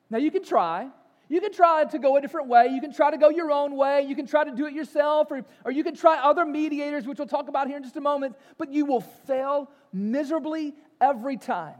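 fiery sermon voice; full-force preaching. Acted. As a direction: hammer the warning home from the pulpit.

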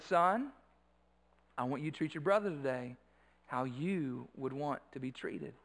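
low, soft retold instruction; quiet fatherly aside. Father retelling a private word to his son, quiet and low.

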